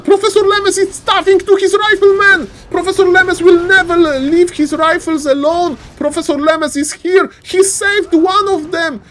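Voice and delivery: high, loud hype call; excited high shouting. Real, not acted. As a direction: scream it like a goal call. Caster hype over saved rifleman.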